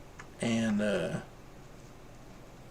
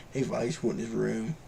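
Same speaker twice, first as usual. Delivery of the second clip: normal talking voice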